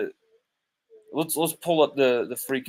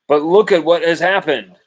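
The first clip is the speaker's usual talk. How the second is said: raised higher and louder, pointing at the proof; loud vindication; 5 semitones higher, 9 dB louder than usual. Real, not acted. Podcast host to his critics, loud and triumphant. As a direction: hammer it home like I told you so.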